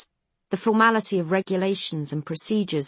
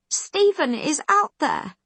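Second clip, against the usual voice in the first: high, loud cry with weight on "out"; "Stephen is out THERE", stress landing on "out"; screaming; 11 semitones higher, 5 dB louder, slower than usual. A narrator's reading. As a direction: Khan screaming at McQueen. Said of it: "she's still screaming at him".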